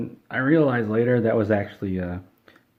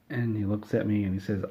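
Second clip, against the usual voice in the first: normal talking voice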